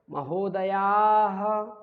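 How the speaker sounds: "महोदयाः" very long, loud, ending in "ha"; loud, drawn-out model of "महोदयाः"; visarga sounded as "ha". Shown as a demonstration. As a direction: stretch "महोदयाः" loudly to a final ha. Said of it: "उच्चारण ऐसे करना है".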